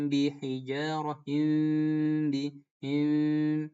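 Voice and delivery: "bihijarahin bihim" very slow, the m of "bihim" nasal and blurred; hidden mim. Shown as a demonstration. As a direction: ikhfa syafawi demo. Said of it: "huruf mim tapi nggak jelas".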